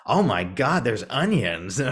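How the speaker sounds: high, loud mock amazement; hammed-up theatergoer exclaiming out loud; imitated audience member. Retelling a third-row lady at Broadway, hammed-up exclamation. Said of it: "this lady in the sort of third row".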